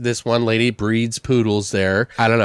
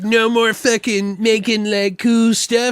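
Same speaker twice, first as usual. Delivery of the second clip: mock scientist complaint; 10 semitones higher than usual. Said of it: "It's like".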